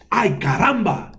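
high cry of alarm; dismayed exclamation. A narrator's reading. Mouse seeing the tooth fly off, dismayed. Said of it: "dijo El Ratón Pérez".

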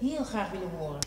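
very soft, hushed voice; hushed whisper. Acted. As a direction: keep it down so they won't hear. Listening at the door.

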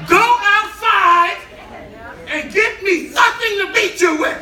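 loud, high, drawn-out mom command; 母亲怒声吩咐. Retold or imitated. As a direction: order the child out at full volume. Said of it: "My mother would go".

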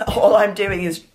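usual speaking voice

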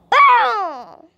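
triumphant loud boom; exclaim. Real, not acted. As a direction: punch out the hit. Game hit reaction.

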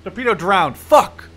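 loud burst of anger at the miss; real gamer rage; frustrated yell. Real, not acted. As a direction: snap out the curse. Torpedo fails, frustrated yell.

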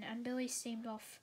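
ordinary delivery, nothing marked

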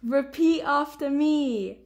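slow, firm instruction. Real, not acted. Child repeats the instruction firmly.

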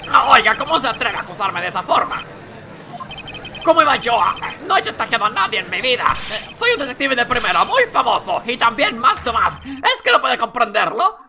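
loud, outraged denial; indignant protest. Acted. Accused detective to the prosecutor, loud indignant denial. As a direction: protest your innocence hotly.

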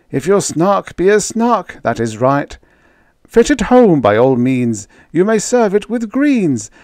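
high, earnest advice in the baker's voice; quoting the uncle. Retold or imitated. Baker repeating his uncle's advice, high and earnest. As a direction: pass on old uncle's counsel. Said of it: "He remarked to me then".

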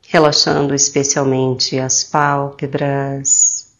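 slow, soothing meditation voice with drawn-out phrase endings; calm, slow guidance with long pauses; guided meditation. Acted. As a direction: guide them gently into relaxation, unhurried.

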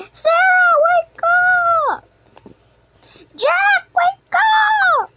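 high, loud wake-up call, the last one slow; calling loudly. Acted. Waking Sarah and Jack for the school trip, loud high calling. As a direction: yell them awake, stretching it out.

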